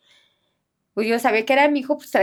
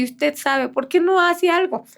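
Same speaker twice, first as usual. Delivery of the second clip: retold angry challenge, higher and louder; angry child; 8 semitones higher, 5 dB louder, slower than usual. Boy challenging his missing friend's grandmother, angry and exasperated. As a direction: demand action, drawn out and raised. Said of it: "viene enojado el niñito".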